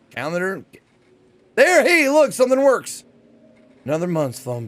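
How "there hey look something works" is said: excited relief. Real, not acted. Finally a click works.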